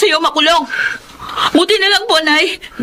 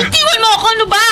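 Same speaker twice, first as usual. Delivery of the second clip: higher, pleading struggle to break free; frightened protest; 7 semitones higher, faster than usual. Acted. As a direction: push him off, voice up in alarm. Struggling against her attacker. (takot na pagpalag)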